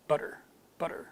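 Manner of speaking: butter with a very short, fast D; American flap T. Shown as a demonstration. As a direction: tap the middle of butter lightly, American style. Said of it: "fast D".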